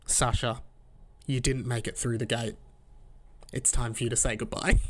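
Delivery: low, solemn TV-host elimination verdict; Osher Günsberg impression. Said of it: "imagine Osher Günsberg coming out".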